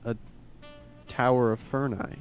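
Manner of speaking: normal talking voice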